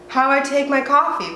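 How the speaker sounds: normal talking voice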